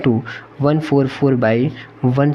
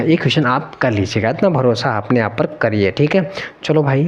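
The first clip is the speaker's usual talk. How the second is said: usual speaking voice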